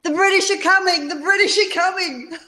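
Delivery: high, hammed-up warning cry; lightly voiced rider calling a warning; mock alarm call. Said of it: "is that where they said".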